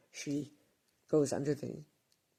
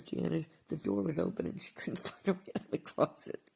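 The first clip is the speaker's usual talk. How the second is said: laughing through it; 6 semitones higher, 4 dB louder than usual. Real, not acted. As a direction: quote breaking into real laughter mid-line. Retelling boyfriend's story, laughing.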